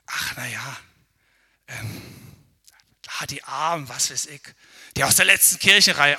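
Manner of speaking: mock condescension, ending loud on Kirchenreihe; a proud churchgoer looks down on the back rows; condescending pity. Mock condescension.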